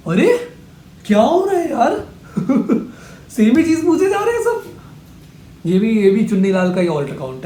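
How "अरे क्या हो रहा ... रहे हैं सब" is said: much higher, laughing in disbelief; exasperated laugh. Real, not acted. Reacting to viewers asking the same question again, exasperated laughing.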